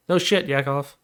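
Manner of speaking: ordinary delivery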